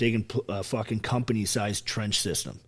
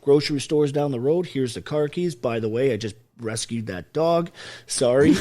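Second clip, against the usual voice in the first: mock breezy rundown; 4 semitones higher, 6 dB louder than usual. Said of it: "I'm like".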